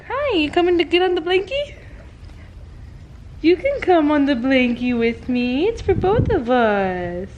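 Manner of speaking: high, sing-song voice for the cat; pet talk. Real, not acted. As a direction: coo to the kitty on the blanket. Talking sweetly to her cat.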